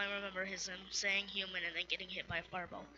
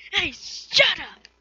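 much higher, louder, slow furious yell; angry shout; 10 semitones higher, 15 dB louder, much slower than usual. Acted. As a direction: shout them down in outrage. Character snaps at onlookers.

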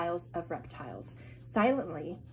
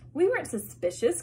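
higher and louder objection; exclaimed; 7 semitones higher, 5 dB louder than usual. Acted. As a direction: jump in to correct her with force. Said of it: "Claus exclaimed".

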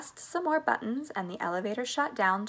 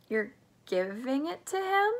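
higher-pitched, incredulous question; astonished gasp; 6 semitones higher than usual. A narrator's reading. Grandpa Joe questions Mister Wonka's gift, astonished. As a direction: gasp it out in disbelief. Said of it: "gasped Grandpa Joe".

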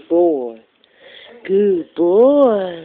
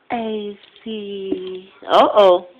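ordinary talk

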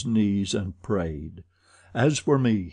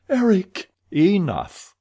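loud, high desperate shouts; crying out; 9 semitones higher, 5 dB louder than usual. A narrator's reading. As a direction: cry out to stop him. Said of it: "crying out in concert with Christine".

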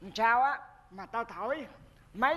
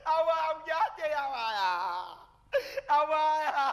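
hammed-up sobbing lament; fake crying; 5 semitones higher than usual. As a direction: bawl theatrically for the dead. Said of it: "Khóc vậy?".

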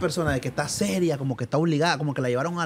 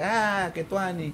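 impressed crowd; 2 semitones higher, 4 dB quieter, much slower than usual. Retold or imitated. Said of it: "la gente".

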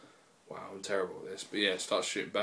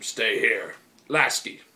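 loud acted military command; raised-voice squad leader order; 9 dB louder, much slower than usual. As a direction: call out orders forcefully. Acts Chief ordering the cadets.

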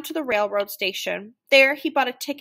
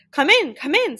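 higher, louder calling-in; raised, hearty storybook welcome; welcoming farmer; 7 semitones higher, 6 dB louder than usual. A narrator's reading. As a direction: call the guest in with a lilt. Farmer calls visitor in to dinner.

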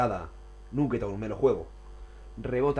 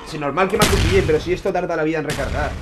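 loud, exasperated griping; angry rant; 4 semitones higher, 7 dB louder than usual. Real, not acted. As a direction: complain loudly at the game. Gamer complaining about reload.

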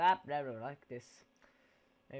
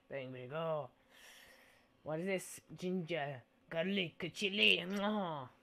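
normal talking voice

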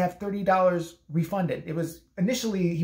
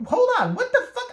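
much higher, louder surprised cry; shocked disbelief; 5 dB louder than usual. Retold or imitated. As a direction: react as if caught completely off guard. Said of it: "I'm like".

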